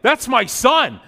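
loud, high retold outburst; indignant protective dad. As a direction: act out jumping to your child's defence.

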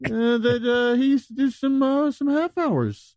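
high-pitched mock-innocent questioning; high sly interviewer. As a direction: ask with fake sweet innocence.

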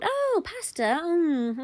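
high, delighted little coo; pleased anticipation. Acted. All three dance around thinking of pasta, pleased. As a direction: relish it with a hum.

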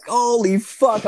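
high, loud, drawn-out exclamation; acted-out stunned outburst; retold astonishment. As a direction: stretch it out in mock amazement. Retold astonishment at dealer tip-off.

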